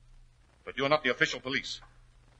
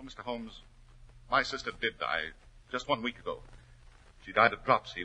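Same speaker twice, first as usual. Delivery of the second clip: normal talking voice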